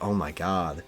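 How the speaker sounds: ordinary delivery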